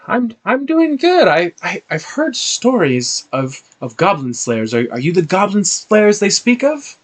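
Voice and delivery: a hint of an awed farm mom; farm mother. Queeleen asking if they are the goblin slayers, lightly high. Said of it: "she says".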